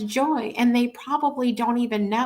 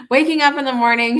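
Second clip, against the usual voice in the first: laughing at her own slip, quicker and louder; 4 semitones higher, 5 dB louder, faster than usual. Real, not acted. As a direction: redo the phrase through a laugh. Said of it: "not in that order, hopefully".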